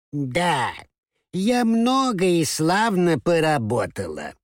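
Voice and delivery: put-on slow, high, mumbling old lady voice; toothless old mouse. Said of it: "прошамкала старушка беззубым ртом".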